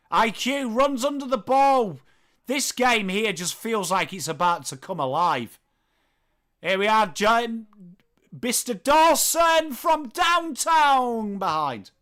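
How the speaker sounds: commentary building to a stretched shout; excited play-by-play. Acted. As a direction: call it live, draw out the big finish.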